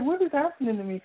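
high-pitched, mildly alarmed retelling; bewildered. Retold or imitated. Telling how her lungs failed her, bewildered. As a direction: replay the baffled reaction. Retelling being unable to breathe.

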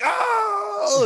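very high, loud astonished whoop; excited shout. Retold or imitated. Host imitates people hearing the verse with a high yelled whoop. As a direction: mimic listeners losing it.

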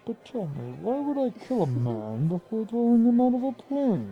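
put-on villain voice, swinging up in pitch; Bane. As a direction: play the masked villain posing a question. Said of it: "You sound like Bane".

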